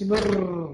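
"مر" with a long, repeating trilled ر; wrong repeated R. Shown as a demonstration. Teacher showing the fault to avoid, "مر" with over-trilled ر. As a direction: roll the ر of "مر" too many times. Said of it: "تتكرر منك كتير".

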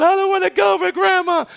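high, loud mock whine of a child; hammed-up child whining about leaving; imitating crying kid. Kids protesting being sent to Grandma, mock crying whine. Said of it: "they crying".

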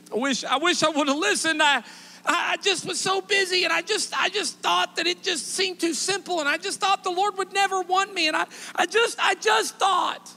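high, wailing plea rising to a shout; desperate pleading sinner. Pretend. Condemned sinner begging God at judgment, high wailing plea. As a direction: beg like a soul condemned to hell. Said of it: "Maybe you could say".

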